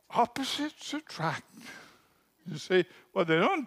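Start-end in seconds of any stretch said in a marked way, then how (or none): 0.1-1.6 gasped, high, starry-eyed gushing; mock gushing wedding guest. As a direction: sigh out opposites attract like a dazzled guest.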